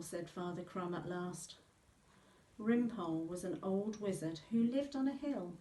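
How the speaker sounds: usual speaking voice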